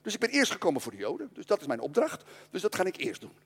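a hint of a tender Jesus; gentle Jesus. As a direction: let him down gently, like a friend. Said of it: "zei Jezus heel aardig, heel attent".

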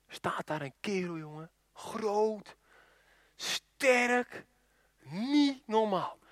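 soft, slow, word by word; hushed awe. Acted. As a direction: marvel quietly at a huge figure. Awed description of the giant. (zacht en langzaam, vol ontzag)

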